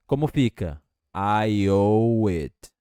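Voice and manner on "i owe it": "I owe it" drawn out slowly; slow it down for the learner to repeat; slow model phrase. Shown as a demonstration. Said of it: "Vai repetindo comigo".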